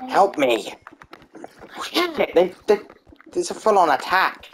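panicked. Real, not acted. Attacked by mobs.

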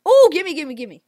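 high, loud eager gimme gimme; real eager chant; greedy gimme. Real, not acted. Grabbing pellets in game.

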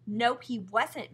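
loud, slow, emphatic denial; insistent. A narrator's reading. Sister contradicting her mother, insistent. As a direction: insist on it like a stubborn child. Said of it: "Mary insisted".

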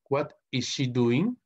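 slowly, each word set apart; slow model question. Shown as a demonstration. Teacher repeating an English example for students, slow and measured.

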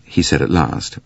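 plain reading voice

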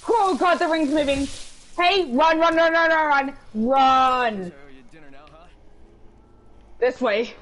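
panicked shouting to run; urgent, breathless flight callout; being chased. Real, not acted. Ring closing, fleeing.

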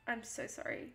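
soft unvoiced apology; whispered sorry. Real, not acted. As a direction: breathe it out under your breath.

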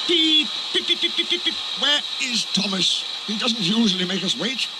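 high, peeping engine fret; fussing. A narrator's reading. Edward waiting for Thomas, high fussing. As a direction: fuss in a high whistle voice. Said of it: "fussed Edward".

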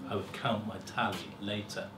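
plain reading voice

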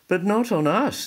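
high, loud frightened oyster cry. Acted. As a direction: shriek it in alarm. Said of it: "the oysters cried".

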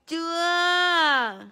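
loud, falling playful scold; a pretend smack in the voice; hammed-up loud scold; mock scolding. Mock punishing baby.

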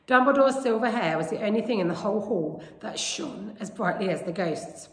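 ordinary delivery, nothing marked

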